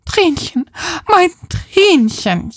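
high, drawn-out lament; wailing. Acted. Woman locked out calling her cat Trinchen, wailing. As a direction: wail it out in a high, pleading voice. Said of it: "jammert sie und rüttelt weiter".

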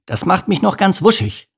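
high irritated complaint; growling. A narrator's reading. Bodo complaining to the mosquito, growling. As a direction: grumble it, fed up. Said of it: "fügte er knurrend hinzu".

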